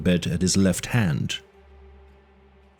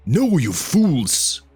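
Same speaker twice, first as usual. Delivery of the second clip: slow, higher exasperated roar; 7 semitones higher, much slower than usual. A narrator's reading. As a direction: roar at the fools in frustration. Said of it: "roared the exasperated Cimmerian".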